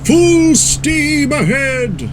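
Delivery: high, long-drawn heroic call; grand call. A narrator's reading. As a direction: stretch each word out grandly. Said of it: "he called grandly".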